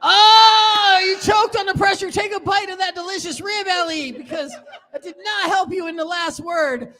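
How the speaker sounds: high, loud excited host yell; excited shout. Real, not acted. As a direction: cheer it out big and high. Host reacts to choked round.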